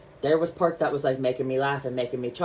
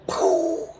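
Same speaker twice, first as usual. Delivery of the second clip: high loud puff mimicking a fart; storyteller mimicking a loud fart; imitated fart noise; 4 dB louder than usual. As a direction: blurt a fake gunshot fart. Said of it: "I can't even mimic it".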